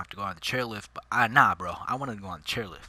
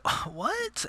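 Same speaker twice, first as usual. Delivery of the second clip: much higher startled Oh what; high, startled mom; surprised mom. Retold or imitated. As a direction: mimic mom's surprise. Mom surprised nobody's there.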